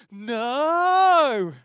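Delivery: sudden loud, high "No!"; shouted no. Retold or imitated. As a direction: blurt it out like a cheeky boy. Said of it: "the other boy goes".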